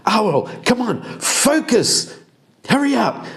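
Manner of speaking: high, urging her to focus; high impatient voice. Acted. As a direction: squeak it, impatient.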